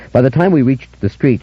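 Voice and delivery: ordinary delivery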